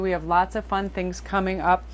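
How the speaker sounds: ordinary talk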